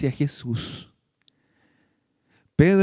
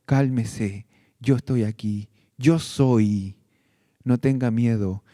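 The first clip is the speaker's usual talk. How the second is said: low, gentle reassuring voice of the Lord; 7 semitones lower than usual. Acted. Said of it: "Él está diciendo hoy".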